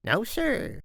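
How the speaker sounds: high-pitched mother replying to the Count; mother's voice. A narrator's reading. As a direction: answer timidly in a high falsetto mother voice. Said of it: "the mother answered".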